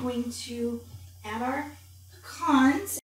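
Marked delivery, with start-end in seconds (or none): none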